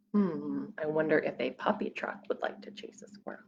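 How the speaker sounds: quick hushed whisper. Acted. Reader whispering a secret to the listening child.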